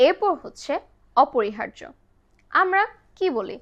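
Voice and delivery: usual speaking voice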